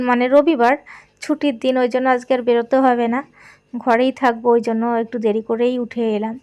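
ordinary delivery

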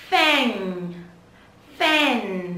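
"Fan" stretched, pitch sliding down into the n; rõ âm cuối. Shown as a demonstration. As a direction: let the N in "Fan" ring on. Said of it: "Nhớ âm cuối chúng ta". (đọc "Fan" kéo dài âm n cuối)

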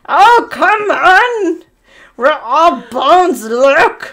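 drawn-out teasing witch. Acted. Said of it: "Witch on the left".

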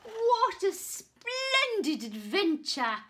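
high, loud delighted cry. Acted. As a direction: beam with joy. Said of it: "twinkled the knight".

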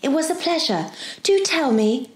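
put-on high, mildly pleading girl; begging. A narrator's reading. Girl urging the professor to confide, begging. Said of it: "she begged".

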